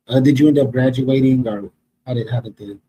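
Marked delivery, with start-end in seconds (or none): none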